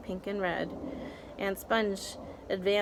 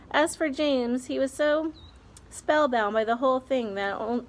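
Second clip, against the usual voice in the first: plain reading voice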